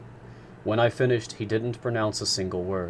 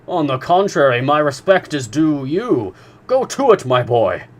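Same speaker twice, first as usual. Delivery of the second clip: high, louder cheering on; encouraging; 6 semitones higher, 9 dB louder than usual. A narrator's reading. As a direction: spur him on with delight. Professor cheers Conseil on.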